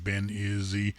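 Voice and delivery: ordinary talk, nothing marked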